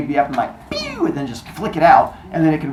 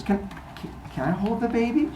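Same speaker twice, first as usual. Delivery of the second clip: timid ask; 7 semitones higher, 9 dB quieter than usual. Retold or imitated. Rhea asks shyly.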